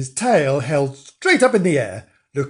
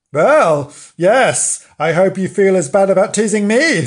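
louder, quick, indignant retort; indignant Nobbs; 5 semitones higher, 6 dB louder than usual. Acted. Nobbs scolding Frank for teasing, indignant. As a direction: answer back, put out and pointed.

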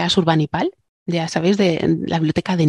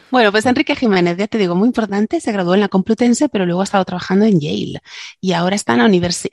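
usual speaking voice

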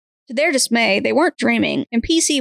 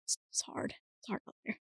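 breathy joking whisper; whispered lament; 22 dB quieter than usual. Real, not acted. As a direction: whisper it like a sad confession. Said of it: "mock lament".

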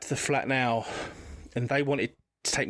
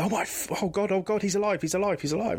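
much higher exclamation of relief; overwhelmed relieved cry; 7 semitones higher than usual. Retold or imitated. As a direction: cry out as a mother who thought her son was dead. Mum finds her missing son alive.

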